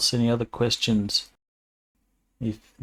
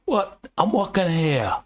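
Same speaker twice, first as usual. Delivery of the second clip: bad New York accent; 9 semitones higher than usual. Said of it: "gonna be absolutely terrible".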